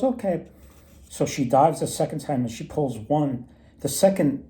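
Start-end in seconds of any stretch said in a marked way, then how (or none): 0.0-0.5 high, light okay; a chirpy elf's reply. Retold or imitated. Elf agrees to dive again, high voice.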